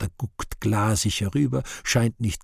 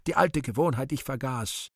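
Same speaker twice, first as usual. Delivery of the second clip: flustered; 6 semitones higher than usual. Acted. God flustered, apologizing.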